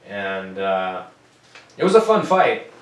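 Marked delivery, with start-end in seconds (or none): 1.8-2.7 excited. Real, not acted. Repeats line with more energy.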